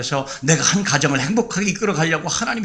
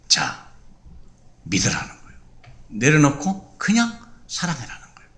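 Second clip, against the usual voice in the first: lower, softer, almost whispered plea; soft tender appeal; 3 semitones lower, 4 dB quieter than usual. Real, not acted. Preacher urges the congregation to let go, hushed. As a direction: draw them in gently with a hush.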